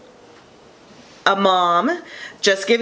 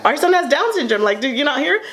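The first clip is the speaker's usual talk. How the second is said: higher, fast, stunned double take; high incredulous outburst, rushing; retold disbelief; 8 semitones higher than usual. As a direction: blurt it out in shocked disbelief to your husband. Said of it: "I looked at my husband like".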